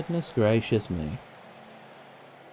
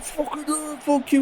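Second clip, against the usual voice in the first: mock aggro fan; 13 semitones higher than usual. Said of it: "There's people being like".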